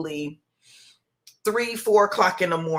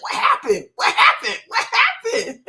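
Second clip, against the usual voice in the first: much higher, louder, frantic imitation of a son; panicked big brother; 5 dB louder than usual. Mother imitating her older son on FaceTime, hammed-up panicked squeal. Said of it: "And he's like".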